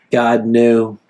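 ordinary talk, nothing marked

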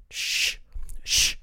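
sharp repeated shush; two separate hushing noises. Acted. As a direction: shush an interrupter. Shushing a movie interrupter.

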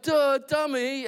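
high, slow teasing duh; mock scolding. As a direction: roll your eyes at him. Mocking the clueless husband.

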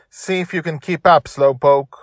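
high, loud taunt; loud, playful goading; teasing yell. A narrator's reading. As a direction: yell it like a kid daring Dad to race. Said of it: "Dragon Little yelled".

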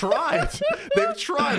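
squeaky high laugh through the words; cracking up while saying it. Real, not acted. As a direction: giggle it out, pitch jumping up. Laughing about girls who tried.

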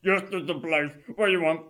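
police officer. Acted.

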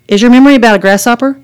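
put-on a child caught off guard by a grasshopper; surprised. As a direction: blurt it out in astonishment. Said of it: "Hannah was surprised".

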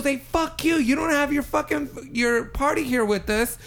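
high-pitched, agitated cab driver imitation; mock yelling back. Cab driver yelling back at the man, agitated mock yell. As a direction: rattle off an indignant retort, without real volume. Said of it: "the cab driver started yelling back".

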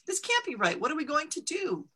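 a mother at her wits' end; anxious mother turning to the townspeople; distressed. Acted.

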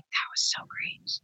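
hushed, pleased whisper; creepy whisper. Retold or imitated. Jeffrey on the phone the next morning, whispering. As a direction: whisper it like a gloating secret. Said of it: "he's like".

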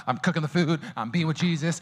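ordinary talk

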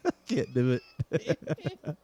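breaking into laughter; can't keep a straight face; laughing. Real, not acted. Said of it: "I start laughing".

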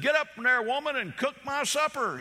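a hammed-up grumpy old husband barking orders; fussing husband. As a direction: order the sick wife out of bed. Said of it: "he fussed at her".

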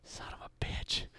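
hushed curse under the breath; whispered curse. Retold or imitated. Realizing he was set up.

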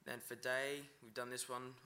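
usual speaking voice

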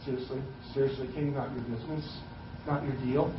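low, soft, quick dismissive mumble; we brush off the king, low and hushed. Retold or imitated. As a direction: wave the king away under your breath. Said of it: "We'd be like".

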